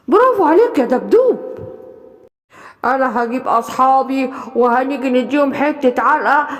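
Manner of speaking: a big friend promising a beating; gruff animal friend. Acted. Friend praises bear, vows revenge.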